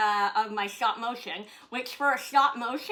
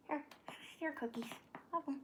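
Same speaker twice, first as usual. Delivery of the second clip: whisper; 5 semitones higher, 12 dB quieter than usual. Real, not acted. Aside to dog.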